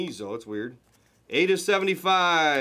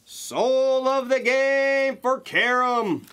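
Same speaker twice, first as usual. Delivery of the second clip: drawn-out, higher and louder hype call; excited announcement; 8 semitones higher, 3 dB louder, much slower than usual. Real, not acted. Breaker hyping the pull to the viewers, drawn out and high. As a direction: stretch it out like a big reveal.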